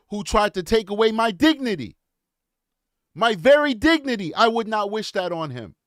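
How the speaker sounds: real, forceful moral appeal; impassioned speech. Real, not acted. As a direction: argue it like an impassioned orator.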